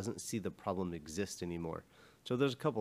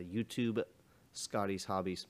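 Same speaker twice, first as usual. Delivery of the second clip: ordinary delivery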